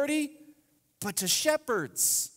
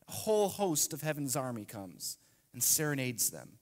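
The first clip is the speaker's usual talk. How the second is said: usual speaking voice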